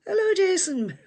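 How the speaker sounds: high laughing hello; sing-song greeting. Real, not acted. Greeting viewer Jason, high laughing hello.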